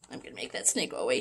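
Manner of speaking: hushed, quick whisper; whispered aside. Real, not acted. Teacher whispers an aside to the kids, hushed. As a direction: drop to a fast whisper.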